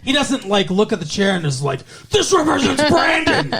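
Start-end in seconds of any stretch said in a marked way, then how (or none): 2.1-3.5 high, hammed-up rage through laughter; over-the-top high-pitched proclamation; mock dramatic outburst. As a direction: declaim it in mock fury. Mock acting out chair smashing.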